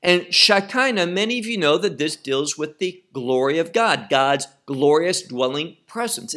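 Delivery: ordinary talk, nothing marked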